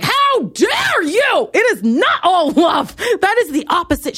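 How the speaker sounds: high, drawn-out mock outrage; outraged yell. Fan reacting to a singer's friendly comment, outraged yell. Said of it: "I just yelled loud enough".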